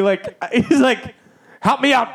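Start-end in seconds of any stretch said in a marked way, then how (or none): 1.6-2.1 high mock yell for help; urgent doctor calling for backup; imitated yelling. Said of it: "then he starts yelling at me".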